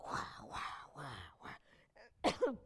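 soft, breathed wah-wah of a wobbling car; hushed car sound effect; imitated car noise. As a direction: mimic the car in a low, repeated whoosh. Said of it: "And that car's going".

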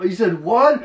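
loud, high, shocked question; outraged disbelief. Retold or imitated. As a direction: throw it back in disbelief. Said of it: "Han bara". (chockad utbrott)

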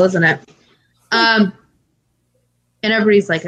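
ordinary talk, nothing marked

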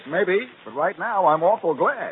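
gloating farewell. Acted. Blackie escapes, gloating at inspector.

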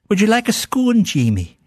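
a hint of a genteel aunt; sweet old aunt. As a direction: offer it like a dainty Scottish aunt. Scottish aunt offering scone.

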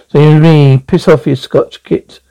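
ordinary talk

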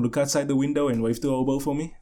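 ordinary talk, nothing marked

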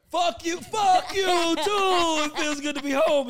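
loud, high gleeful cussing, happy to be home; a loud, high mock tirade. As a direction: belt it out like you're back on your block. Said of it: "be like".

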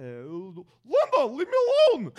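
loud, high, exaggerated dad voice; exasperated dad, hammed-up and loud; dad impression. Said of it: "So my dad would sound like".

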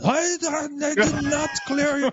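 high-pitched imitation of an irate controller; mock outburst, drawn out and high; losing it. Controller refusing pilots' readback, mock losing it. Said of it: "Oh, and he lost it".

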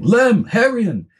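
high, slow yelled names; urgent yell for his brothers; shouting names. Acted. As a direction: call brothers across the yard.

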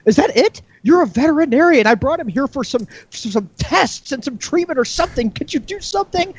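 very high put-on woman's voice; indignant pig owner. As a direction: protest in hammed-up falsetto distress. Pig owner protests in joke.

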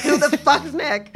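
retold irritation breaking into laughter; mock annoyance. Daughter imitating her dad called Nick in therapy, annoyed. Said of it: "he's already annoyed".

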